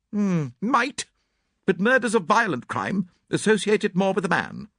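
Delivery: put-on high, brisk, blunt older lady; clipped spinster, sure of herself; Miss Howard. A narrator's reading. Miss Howard voiced in reading.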